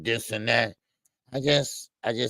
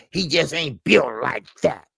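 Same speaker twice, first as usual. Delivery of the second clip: louder put-on impression voice; Southpaw voice; 4 dB louder than usual. Co-host quoting a verdict on the fighter, Southpaw voice. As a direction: put on a voice, punching it out. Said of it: "in my southpaw voice".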